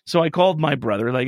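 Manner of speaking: ordinary talk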